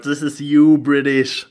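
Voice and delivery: loud, teasing jab at a friend. Real, not acted. Teasing friend about his thinning hair, loud. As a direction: call it out loudly, ribbing him.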